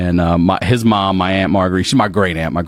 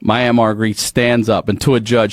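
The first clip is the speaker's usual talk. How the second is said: normal talking voice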